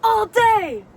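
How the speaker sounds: high, loud hollered catchphrase; loud, high-pitched hype call; imitated catchphrase shout. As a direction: holler it like a hyped-up somebody. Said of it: "a certain somebody says".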